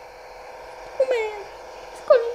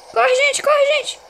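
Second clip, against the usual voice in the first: panicked shout; 7 semitones higher, 4 dB louder than usual. Real, not acted. Panicked player urging the team.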